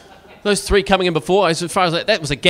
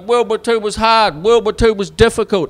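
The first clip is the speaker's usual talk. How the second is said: whining grandad; 4 semitones higher, 7 dB louder than usual. Retold or imitated. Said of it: "He's like".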